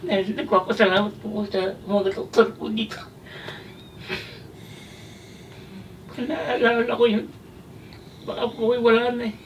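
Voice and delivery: tearful, voice high and breaking; choked-up thanks through tears; crying. Real, not acted. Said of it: "Naiiyak kayo". (umiiyak habang nagpapasalamat)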